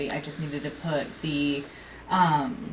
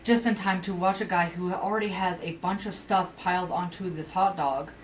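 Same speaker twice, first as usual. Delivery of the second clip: usual speaking voice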